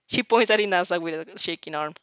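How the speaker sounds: plain reading voice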